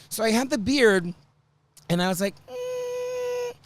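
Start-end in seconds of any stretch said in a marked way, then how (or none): 2.5-3.5 much higher, mock whimpering; whiny high voice. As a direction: whine it like a neglected child. Whiny self-quote, very high.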